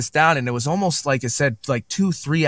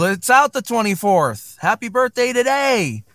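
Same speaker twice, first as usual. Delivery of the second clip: high-pitched, celebratory cheer; excited birthday shout-out; 10 semitones higher than usual. Real, not acted. Host wishes co-host happy birthday on air, excited. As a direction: sing out the good wishes, pitch soaring.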